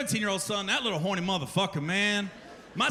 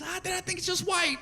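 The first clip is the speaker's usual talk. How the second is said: son's answer; 9 semitones higher than usual. Retold or imitated. Son voiced answering dad.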